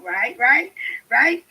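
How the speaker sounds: usual speaking voice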